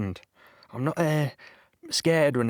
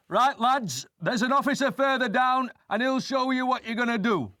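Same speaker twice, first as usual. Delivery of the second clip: much higher, brisk sergeant's call to the lads; sergeant's order; 12 semitones higher than usual. Retold or imitated. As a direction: bark it out like an army sergeant. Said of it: "Sergeant says".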